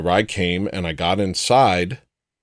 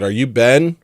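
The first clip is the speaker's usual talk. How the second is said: louder, lifted call across the lot; calling over; 4 semitones higher, 5 dB louder than usual. Retold or imitated. Calling over to the stranger across the lot, a raised question. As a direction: call the question to someone far off. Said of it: "I called over to him".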